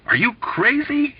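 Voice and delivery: high, frantic hissed question. A narrator's reading. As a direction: hiss it in a panic. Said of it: "he hissed frantically".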